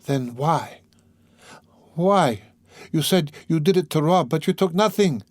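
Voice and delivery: high, pleading woman's voice; quick, grasping question. A narrator's reading. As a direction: press him, clutching at a last hope. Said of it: "she asked quickly, catching at a straw".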